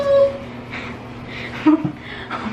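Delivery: breathy high-pitched giggle. Real, not acted. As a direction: giggle helplessly. Laughing while bathing dog.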